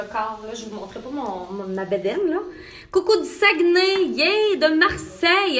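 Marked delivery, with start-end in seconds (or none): 2.9-5.6 high, loud cheering hello; excited greeting. Real, not acted. Host saluting viewers from Saguenay and Marseille, excited. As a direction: cheer each place loudly, pitched high.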